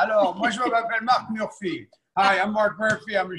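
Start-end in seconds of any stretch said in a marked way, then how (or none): 0.0-1.9 put-on French-accented self-intro. Chef joking to the call in a mock French accent. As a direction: introduce yourself in hammed-up French, "Mark Murphy" with French vowels. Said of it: "I have to have the accent".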